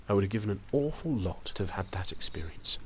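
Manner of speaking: soft unvoiced whisper of regret. Old man confessing his regret to young writer, whispering.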